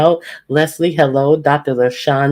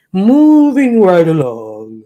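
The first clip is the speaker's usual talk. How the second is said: loud sing-song; 3 semitones higher, 7 dB louder, slower than usual. Real, not acted. Host moves on, loud drawn out.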